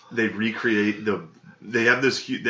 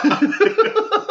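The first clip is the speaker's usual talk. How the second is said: shrieking laugh; high squealing laugh; 8 dB louder than usual. Real, not acted. Laughing at own story.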